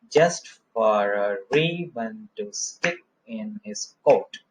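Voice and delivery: slow rhythmic reading, Just and ribbon stressed; dactylic scansion. Shown as a demonstration. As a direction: beat it out slowly, hitting Just, ribbon, stick, coat. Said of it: "और इस तरह से ये आगे बढ़ेगा". (Just और ribbon पर ज़ोर देकर धीमी लय)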